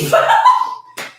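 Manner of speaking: squealing laugh; high squeal laugh. Real, not acted. Burst of laughter.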